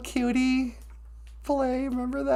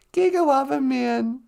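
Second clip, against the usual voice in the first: ordinary talk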